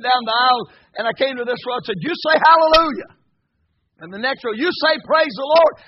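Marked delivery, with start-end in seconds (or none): 2.0-3.2 high, loud preacher's command; rallying call. Retold or imitated. Calling to a row of congregation. 4.5-5.7 high, loud preacher's command; rallying call. Retold or imitated. Calling to the next row.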